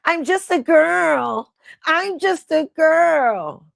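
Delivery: high, loud, drawn-out mock wail; exaggerated helpless whine; mock crying. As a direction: wail it slowly like a hammed-up crybaby. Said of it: "choking up and crying".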